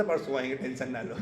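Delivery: low, soft quoted promise; offhand reassurance. Retold or imitated. Krishna putting off the other gopis, offhand reassurance. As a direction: toss it off quietly, imitating a soothing excuse.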